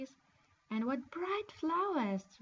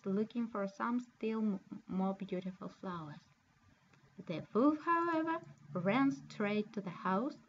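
plain reading voice

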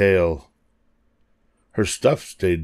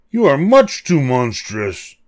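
higher, louder monster protest; mild shriek; 5 semitones higher, 3 dB louder than usual. A narrator's reading. Monsters scared off by Lucy, mild shrieking protest. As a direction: protest in mock fright, pitched up. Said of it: "they shrieked".

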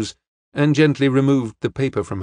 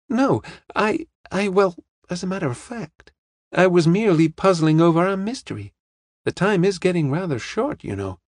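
higher, hesitant start, then explaining; young woman; 7 semitones higher than usual. Acted. Young woman admits she was puzzling over the mystery, higher voice. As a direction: voice a young woman, pitched well up.

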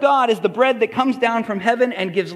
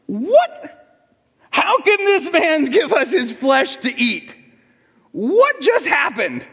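outraged disbelief; 5 semitones higher than usual. Retold or imitated. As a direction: sputter in scandalised disbelief.

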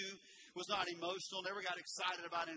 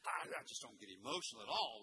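imitating an unemotional churchgoer; 5 semitones lower, faster than usual. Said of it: "some who have".

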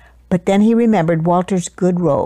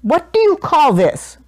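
high-pitched, indignant challenge; angry roar; 6 semitones higher than usual. A narrator's reading. Duke confronting the baker, high angry roar. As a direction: demand an answer in outrage. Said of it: "roared the Duke".

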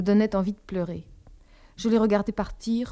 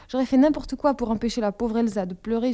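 ordinary delivery, nothing marked

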